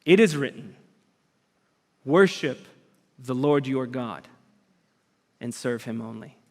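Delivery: solemn quote fading to a hush; reverent quote. Retold or imitated. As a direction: let the scripture fall away into reverence. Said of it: "And Jesus responds".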